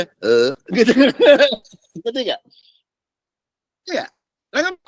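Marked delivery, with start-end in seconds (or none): none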